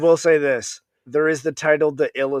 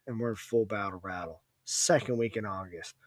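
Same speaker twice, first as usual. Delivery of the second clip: normal talking voice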